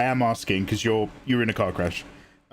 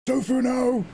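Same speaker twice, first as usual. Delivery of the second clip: mock alarm; 10 semitones higher than usual.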